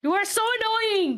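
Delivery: exasperated. Real, not acted. Bickering over undo mistake.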